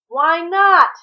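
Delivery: high loud yell down the hall. A narrator's reading. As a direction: call through a closed door, loud. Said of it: "he yelled down the hall and through the wood".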